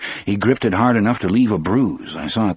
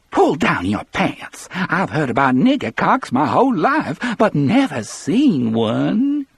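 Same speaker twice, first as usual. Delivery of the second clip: crazed sick woman; 11 semitones higher, 3 dB louder than usual. A narrator's reading. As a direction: voice a deranged invalid's jeering demand. Melly, crazed, demands crudely.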